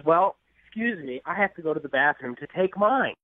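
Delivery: ordinary talk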